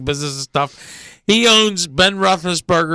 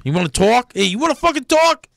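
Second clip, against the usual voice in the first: New York tough guy; 7 semitones higher than usual. Pretend. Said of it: "New York accent".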